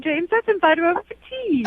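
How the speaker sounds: put-on high-pitched caricature of British accent; mock British parents. Woman plays her date's parents inviting her to tea, mock British. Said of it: "call my parents in London".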